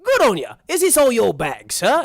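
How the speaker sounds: loud, high, obliging servant voice; eager frog chauffeur. Acted. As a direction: chirp up helpfully to the guest. Frog chauffeur greets guest.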